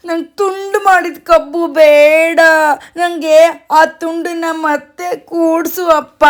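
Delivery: high, drawn-out tantrum whine; hammed-up wailing toddler; stubborn crying child. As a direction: beg dad insistently through mock tears. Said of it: "ಅಂತ ಹಠ ಮಾಡಕ್ ಶುರು ಮಾಡ್ತಾನೆ".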